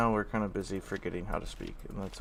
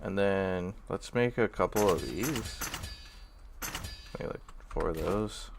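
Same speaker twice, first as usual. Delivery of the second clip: ordinary talk, nothing marked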